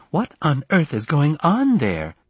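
high, slow, indignant reproach; offended mother on the phone; aggrieved mother. Acted. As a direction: draw out the disapproval, pitched high.

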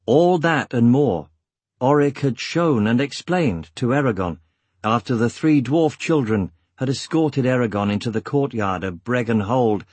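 plain reading voice

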